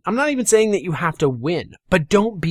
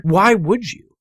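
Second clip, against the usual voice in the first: ordinary talk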